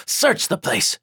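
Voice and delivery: a vampire boss shouting at his minions; barked order. Acted. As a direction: order the men to tear it apart.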